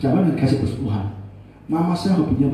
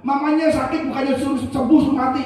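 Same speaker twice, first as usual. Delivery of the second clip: imitated angry reproach, pitched way up; scolding; 10 semitones higher than usual. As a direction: play the outraged crowd telling him off. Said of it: "dimarahi banyak orang".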